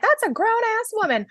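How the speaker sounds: high-pitched mock correction; mock objection. Acting out someone's indignant correction.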